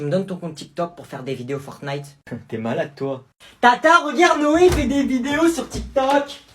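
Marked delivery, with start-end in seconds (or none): 3.6-6.4 high, loud tattle; tattling kid. Acted. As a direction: call the aunt to snitch. Cousin tattling to aunt.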